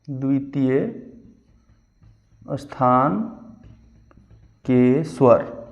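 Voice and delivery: द्वितीय, स्थान, के स्वर with long pauses between; dictating slowly. Shown as a demonstration. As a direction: dictate each word for notes. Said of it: "teacher dictates slowly, word by word". (रुक-रुक कर लिखवाना)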